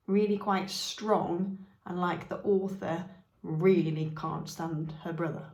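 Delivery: ordinary delivery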